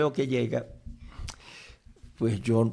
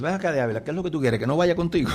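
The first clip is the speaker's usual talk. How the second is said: higher-pitched retort, rising in intensity; mock indignant challenge; 6 semitones higher, 4 dB louder, much faster than usual. Friend confronting the preacher who discourages him from coming, indignant. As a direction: imitate an affronted friend demanding an answer.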